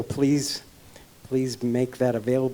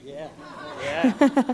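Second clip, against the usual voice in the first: sexy voice; 12 semitones higher than usual. Pretend. Put-on sexy voice continues.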